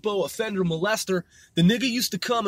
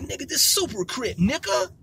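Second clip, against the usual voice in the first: higher, cocky imitation of the little dude; higher mock Crip boast; mock gangster boast; 6 semitones higher than usual. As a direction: show off a silly gang sign voice. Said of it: "He was like".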